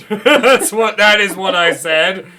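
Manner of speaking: laughing protest, high and loud. Real, not acted. Game master defending a silly shop name to players, loud and laughing. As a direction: insist loudly through your laughter.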